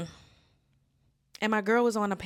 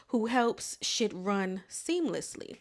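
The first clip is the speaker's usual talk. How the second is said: normal talking voice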